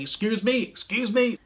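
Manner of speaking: big voice, high, insistent interrupting call. Retold or imitated. Elderly restaurant manager interrupting the magician across the room, calling out. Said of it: "a big voice calling across the restaurant".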